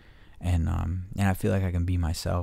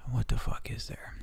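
whisper; 4 semitones higher, 4 dB quieter than usual. Real, not acted. Muttering to himself.

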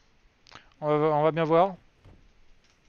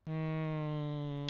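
ordinary talk, nothing marked

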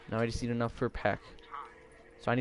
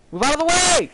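shouting; 10 dB louder than usual. Real, not acted. Yelling at teammate in game.

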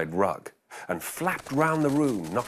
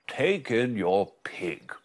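put-on wise old sage; slower than usual.

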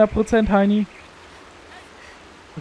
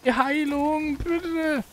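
pleading; 9 semitones higher, 5 dB quieter, much slower than usual. Pretend. Begging the team for healing.

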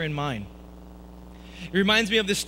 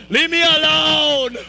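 louder, high, slow whiny protest; upset kid; 8 semitones higher, 9 dB louder, much slower than usual. Retold or imitated. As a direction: wail it like a kid at the plate. Kid at bat, hated it.

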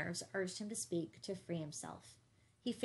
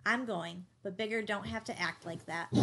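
plain reading voice

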